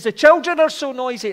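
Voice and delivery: loud, high complaining shout over the noise; retold shout. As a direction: shout it to be heard in the hall. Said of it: "he had to shout it".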